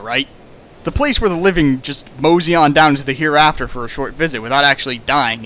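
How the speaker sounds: usual speaking voice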